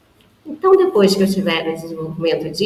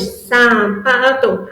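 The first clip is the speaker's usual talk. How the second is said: syllable demo; 2 semitones higher, much slower than usual. Shown as a demonstration. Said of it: "Eu tenho três cartões".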